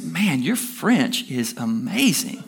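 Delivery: slow, drawn-out admiration; slowly savored mock amazement; impressed compliment. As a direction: act out an amazed compliment, stretching the words. Man compliments woman after service.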